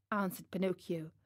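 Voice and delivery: plain reading voice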